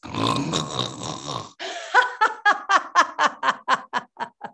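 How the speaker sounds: snoring then dreamy laugh; happy dream laughter. Shown as a demonstration. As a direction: snore in, then laugh out in your sleep. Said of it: "happy dream laughter".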